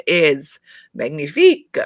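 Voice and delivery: mock French flourish on magnifique; French accent. Vlogger hamming a French word for her audience, French accent. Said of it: "doing such, like, crazy accents today".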